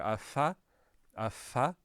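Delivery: "fa" blown through nearly closed lips; airy two-lip f in "fa"; lips close together. Shown as a demonstration. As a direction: make the f of "fa" with both lips, not touching. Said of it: "lips are just kind of close together".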